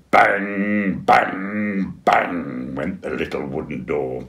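ordinary delivery

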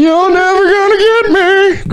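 high squeaky cartoon taunt; sing-song it like the cartoon. Quoting Greased Up Deaf Guy.

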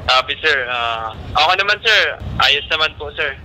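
crackly two-way radio voice; over walkie talkie. Real, not acted. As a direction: heard through a handheld radio. Radio check with distant station.

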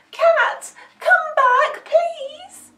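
high, loud, desperate puppy call; panicked, pleading yelp; yelping for help. A narrator's reading. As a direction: cry out for the cat at full voice. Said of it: "at the top of her voice".

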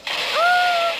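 short high loud scream; startled scream. Real, not acted. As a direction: scream at the jump scare. Sudden scare in horror game.

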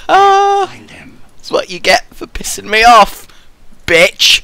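slow, high villainous gloat. Pretend. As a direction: savour the revenge. Gloating after using ability.

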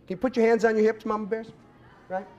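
ordinary delivery

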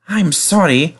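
plain reading voice

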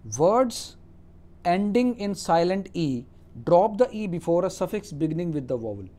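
slow, deliberate dictation of the rule; reading a rule aloud. Acted. Teacher dictates the English spelling rule to students, slow and deliberate. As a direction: read it out slowly, word by word.

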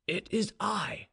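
a touch of tremulous feeling; trembling with emotion. A narrator's reading. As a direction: answer slowly, holding back emotion. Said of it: "in a voice trembling with emotion".

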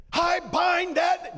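hammed-up rebuke shouted slowly; mock shout. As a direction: fake thundering grandma command. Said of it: "No, she did not".

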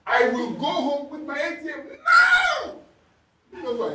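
slow, loud, defiant declaration; indignant shout. Retold or imitated. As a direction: shout slowly, refusing to back down. Retold confrontation with gate man.

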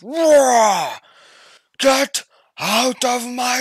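Shrek roaring. Retold or imitated. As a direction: bellow it like an angry ogre in his swamp. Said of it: "Shrek, shut the fuck up, you loud motherfucker".

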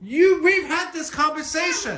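high-pitched, exasperated telling-off; exasperated scolding. Real, not acted. Father scolds child.

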